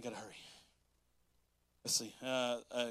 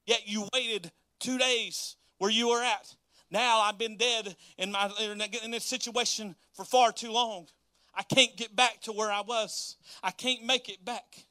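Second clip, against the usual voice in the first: pleading; 6 semitones higher, 6 dB louder than usual. Acted. Doubting believer complains to God.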